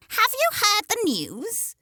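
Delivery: high, slow prying hen; hen's gossip voice. Acted. Narrator voicing a gossiping hen, high and slow. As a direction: chirp it up like a nosy hen.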